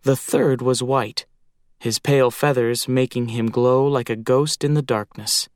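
usual speaking voice

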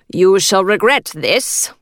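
slow, menacing male voice; menacing man. A narrator's reading. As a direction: attacker threatening the lady, slow and menacing. Attacker threatens her.

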